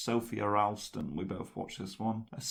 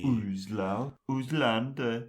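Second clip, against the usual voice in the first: mock Turkish pronunciation; Turkish accent; 3 semitones higher, 4 dB louder, slower than usual. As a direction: roll the name in a put-on Turkish accent. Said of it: "doing your Turkish accent".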